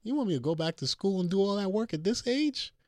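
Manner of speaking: a doctor, at my age, in mock shock; incredulous disbelief. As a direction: balk at the idea, stunned. Shocked at mom's suggestion.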